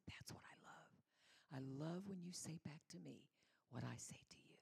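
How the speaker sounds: God whispering his delight; tender whisper. Acted. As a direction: hush it close like a secret love. God speaks tenderly about being heard.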